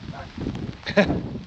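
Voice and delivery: soft unvoiced chuckle; quiet breathy laugh. Real, not acted. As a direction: laugh under your breath. Laughs after promise.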